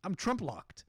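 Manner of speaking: ordinary talk, nothing marked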